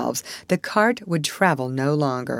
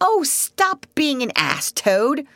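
a mild, higher exasperated plea; despairing cry; 9 semitones higher, slower than usual. A narrator's reading. Mole despairing at entranced Toad, mildly exasperated. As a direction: plead with him, half despairing. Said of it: "cried the mole despairingly".

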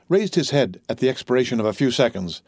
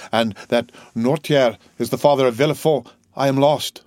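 plain reading voice

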